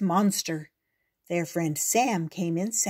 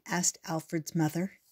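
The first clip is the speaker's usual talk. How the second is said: usual speaking voice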